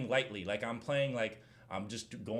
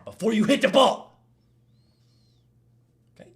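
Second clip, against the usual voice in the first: loud, raised shout of protest; exasperated retort; 10 semitones higher, 15 dB louder than usual. Retold or imitated. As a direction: yell the point home in a retold argument. Acted quarrel over the foul.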